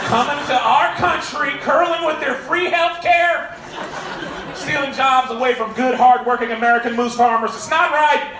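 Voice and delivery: a comic blowhard's outrage at Canada; hammed-up outrage. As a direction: rant like an indignant patriot. Mock outraged patriot rant.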